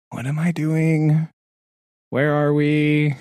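high, drawn-out bewilderment; mock disorientation. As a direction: slowly wonder aloud, acting lost. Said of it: "took a few days to kind of be like".

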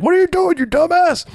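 high, loud mock New York cabbie. New York cabbie yelling at Kirk, high mock outburst. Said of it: "this New York cabbie".

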